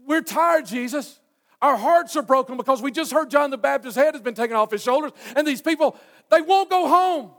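high, loud complaint; exasperated disciples. Acted. Tired disciples complaining to Jesus about lingering crowds, exasperated. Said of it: "And the disciples say".

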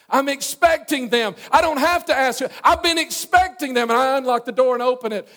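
high, loud fervent preaching. Acted. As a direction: preach it out with full force. Preaches at full force.

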